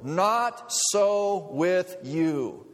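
drawn-out emphatic verse; slow emphatic quote. Acted. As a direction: lay it down word by word. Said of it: "But Jesus says".

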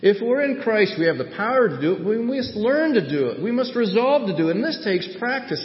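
high-pitched, urgent sermon delivery; forceful preaching. Real, not acted. Preacher urging the congregation to resolve and practice, forceful preaching. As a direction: press each resolve on the listeners.

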